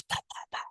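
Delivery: soft breathy pow pow pow; quiet mock gunshot noises; whispered gunshots. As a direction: mimic distant gunfire under your breath. Imitating guns at shooting range.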